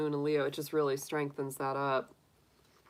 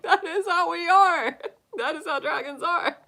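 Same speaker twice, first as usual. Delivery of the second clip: laughing proudly; 12 semitones higher, 5 dB louder than usual. Retold or imitated. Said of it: "I was like".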